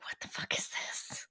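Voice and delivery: hushed, voiceless whisper; student whispering at a hidden sensor; whispered shock. Retold or imitated. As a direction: whisper it as if finding a bug under the desk. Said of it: "be like".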